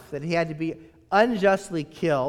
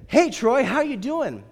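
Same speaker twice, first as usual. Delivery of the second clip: higher, bright-voiced salesman greeting; chirpy telemarketer; 7 semitones higher than usual. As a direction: play the overly friendly caller. Telemarketer greeting acted in sermon.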